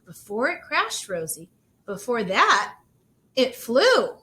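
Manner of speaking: rising to a loud "flew"; building to triumph. Acted. Aunt reassuring Rosie, building to a loud "it flew". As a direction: land hard on "it flew".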